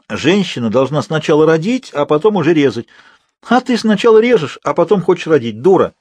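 high, forceful telling-off; scolding. Acted. Older roommate berating the girl, high and harsh. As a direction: scold with rising exasperation and volume.

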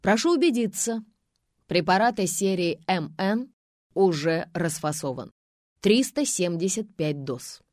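loud, measured official announcement; well-trained announcing voice. A narrator's reading. Policeman announces the evidence to witnesses, well-trained voice. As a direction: project it clearly, slowing on the figure. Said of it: "Хорошо поставленным голосом объявил".

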